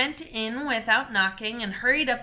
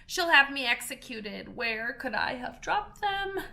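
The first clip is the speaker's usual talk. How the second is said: fretful muttering rabbit; 6 semitones higher than usual. Acted. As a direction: fret like a flustered little rabbit. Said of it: "muttering to itself".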